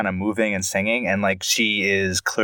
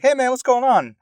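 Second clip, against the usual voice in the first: high greeting voice; 3 dB louder than usual. Retold or imitated. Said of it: "he's like".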